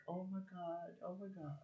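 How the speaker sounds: quietly stunned, half to herself; hushed stunned oh my God. Retold or imitated. Her shocked self-quote.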